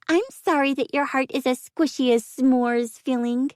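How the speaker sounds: high squeaky mocking voice; squeaky teasing. A narrator's reading. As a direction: tease in a tiny squeak.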